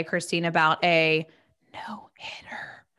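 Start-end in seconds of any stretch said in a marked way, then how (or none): 1.7-2.9 hushed whisper to avoid a jinx. Shown as a demonstration. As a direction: whisper it like a secret. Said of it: "I'm gonna whisper".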